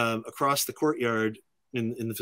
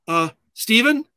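calling out; 9 semitones higher, 7 dB louder than usual. Retold or imitated. Calling out to Steven across courtyard.

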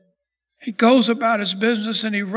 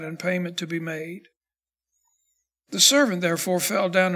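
measured, lower Bible reading voice; scripture reading; 4 semitones lower than usual. Acted. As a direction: read the parable steadily and solemnly. Reads the scripture passage aloud.

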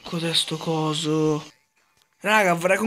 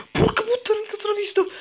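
much higher, startled curse; shocked outburst; 13 semitones higher, faster than usual. Real, not acted. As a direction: blurt it out as if caught off guard. Sudden shocked outburst in game.

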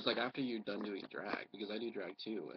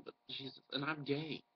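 normal talking voice

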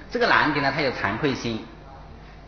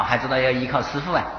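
ordinary delivery

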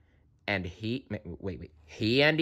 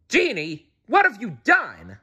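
higher, dismayed shout; 10 semitones higher than usual. A narrator's reading. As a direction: Aladdin cries out at the genie. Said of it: "Aladdin shouted".

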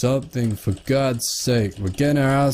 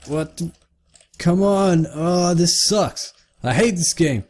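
high-pitched, fed-up complaint; real frustration, pitched up; exasperated whine; 6 semitones higher than usual. Real, not acted. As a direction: whine at a game that won't work. Frustrated at broken controls.

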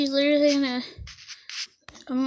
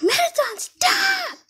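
much higher, loud shrieked command; character shouting stop; 5 dB louder, slower than usual. Acted. Girl character yelling at Mettaton to stop. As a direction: scream at him to quit. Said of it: "She's like".

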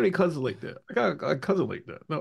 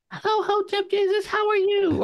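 very high, squeaky mock greeting; squeaky woman's voice. Host mocking the idea of a giant woman's squeaky voice. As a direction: put on an absurdly squeaky, high voice. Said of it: "like, a squeaky voice".

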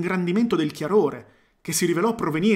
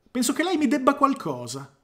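indignant demand; 4 semitones higher than usual. A narrator's reading. Clark demands apology.

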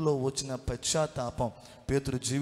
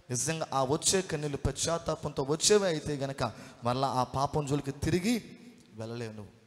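ordinary delivery

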